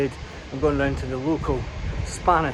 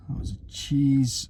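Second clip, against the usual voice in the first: ordinary talk, nothing marked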